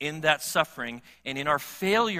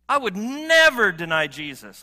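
I would never deny Jesus, much louder and higher; loud protest; 5 semitones higher, 11 dB louder than usual. Retold or imitated. Preacher voicing his own boast to the congregation, loudly. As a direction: belt out the protest with full force. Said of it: "I find myself saying that".

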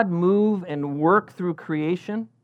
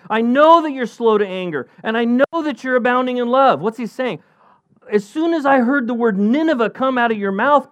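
higher, louder, aggrieved prophet voice; Jonah pleading with God; 8 semitones higher, 4 dB louder than usual. Acted. Jonah complaining to God about Nineveh, higher and louder.

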